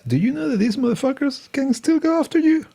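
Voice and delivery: high-pitched mock disbelief; incredulous judge, imitated. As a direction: play a judge pointedly asking in a high voice. Acted judge warning the defense.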